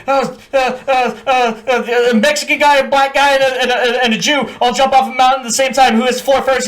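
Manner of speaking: high, stammering mock panic; flustered recruit. Recruit ordered to tell kill hat a joke, panicked stammer. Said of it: "I'm like".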